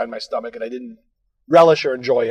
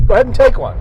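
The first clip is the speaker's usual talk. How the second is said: coworker offer; 11 semitones higher, 5 dB louder, faster than usual. Retold or imitated.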